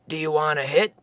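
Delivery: low, easygoing Sasquatch voice; lightly voiced laid-back Bigfoot. A narrator's reading. Sasquatch offers pipe.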